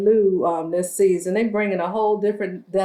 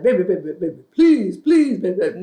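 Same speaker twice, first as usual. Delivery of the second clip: pleading; 3 semitones lower, faster than usual. Retold or imitated. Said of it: "I can hear him like".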